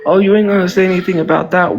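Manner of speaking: ordinary talk, nothing marked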